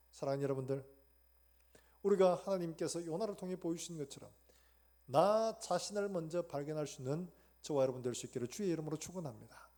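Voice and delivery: loud, ringing blessing from the pulpit; forceful preaching. Acted. Pastor blessing the congregation, loud and ringing. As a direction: proclaim it to the whole congregation.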